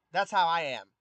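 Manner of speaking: ordinary delivery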